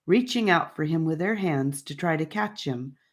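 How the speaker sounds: plain reading voice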